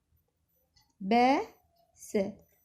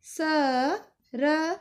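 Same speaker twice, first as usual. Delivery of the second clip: very slow, letters stretched apart; slow sounding out; 4 semitones higher, much slower than usual. Shown as a demonstration. Teacher showing pupils how to sound it out, very slow. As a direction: sound out each letter slowly. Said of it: "अक्षरों को जोड़ के".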